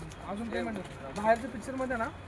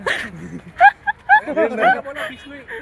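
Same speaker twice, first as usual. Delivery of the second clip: burst of high laughter; 8 dB louder than usual. Real, not acted. Laughing at documentary joke.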